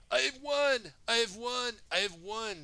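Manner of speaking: high, loud triumphant villain cry; gloating villain. Acted. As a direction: crow over the win like a villain. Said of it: "That's what Samuku said".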